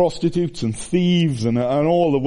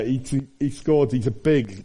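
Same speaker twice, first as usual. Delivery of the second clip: usual speaking voice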